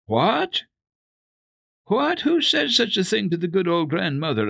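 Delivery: a kind lady protesting in surprise; friendly surprise. Acted. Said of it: "asked a friendly voice".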